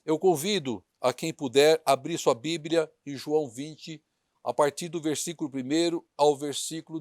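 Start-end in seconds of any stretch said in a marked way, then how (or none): none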